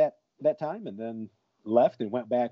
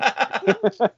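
high laugh; 11 semitones higher than usual. Real, not acted. Laughing at own story.

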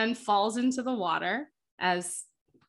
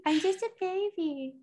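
put-on high, softer baby voice; hammed-up little voice, pitched way up; mock baby voice; 10 semitones higher, 5 dB quieter than usual. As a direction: echo a helpless baby, small and high.

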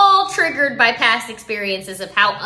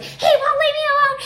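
mock child's wail, very high; high-pitched tantrum cry, hammed up; crying four-year-old; 3 dB louder than usual. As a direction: imitate a little kid crying in protest. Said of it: "he just cries even more".

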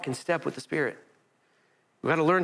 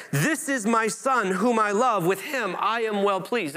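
voice from heaven; 6 semitones higher, slower than usual. Acted. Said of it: "a voice from heaven said".

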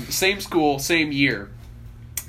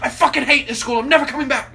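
high, raised-voice outburst; mock tantrum; 10 semitones higher, 4 dB louder, faster than usual. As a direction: imitate a kid storming out. Imitating kid storming out of school.

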